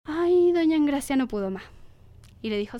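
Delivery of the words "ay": ordinary delivery, nothing marked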